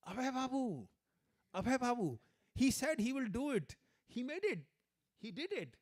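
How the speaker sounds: loud, high-pitched exclaiming; very excited. Retold or imitated. Scholar recognising his godbrother's picture, wildly excited. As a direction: cry out in amazed jubilation. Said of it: "So he got so excited".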